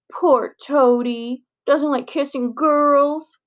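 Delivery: slow, drawn-out mock pity; mocking baby talk. Bully mocking Ben about the frog, slow sarcastic pity. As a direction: taunt him, stretching every word.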